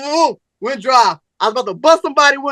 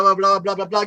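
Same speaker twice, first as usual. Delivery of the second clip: ordinary talk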